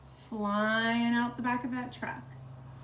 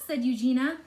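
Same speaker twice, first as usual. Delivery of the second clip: ordinary delivery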